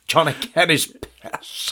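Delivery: slow, laughing Northern Irish drone; mock the commentator, slowed right down; a deliberately terrible Northern Irish accent; bad accent. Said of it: "my accents are gonna be terrible".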